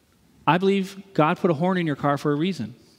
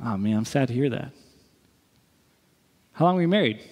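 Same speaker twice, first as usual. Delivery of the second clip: ordinary talk, nothing marked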